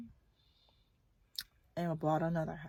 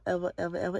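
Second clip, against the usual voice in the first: usual speaking voice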